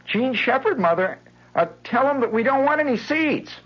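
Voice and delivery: put-on very high, loud girlish brush-off; dismissive Patty. Girl tells her mother to send the seed boy away. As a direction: wave him away with scorn.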